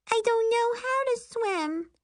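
put-on very high small-child whine; high-pitched childish complaint; upset little girl. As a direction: whimper an objection like a kid.

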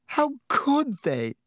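high, slow, choked-up plea; choking sob. Acted. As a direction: let the words break with grief. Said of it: "she choked".

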